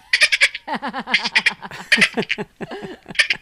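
high chattering dolphin squeaks; dolphin clicks. Shown as a demonstration. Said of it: "imitate dolphins".

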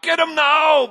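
high, loud attack order; crying out. Acted. Dirty Joe ordering his boys to attack, shouting. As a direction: charge them, full voice. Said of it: "Joe cried out".

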